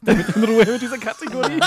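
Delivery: high, laughing mock plea to be left alone; a fed-up object begging for peace; exasperated toilet voice.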